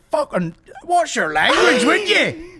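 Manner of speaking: high-pitched, drawn-out telling-off; mock-outraged reprimand; indignant scolding. Acting out indignant listeners' scolding.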